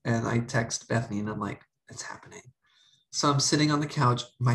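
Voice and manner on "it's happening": whispered, secretive announcement; hushed dread. Retold or imitated. Texting Bethany before coming out, hushed whisper.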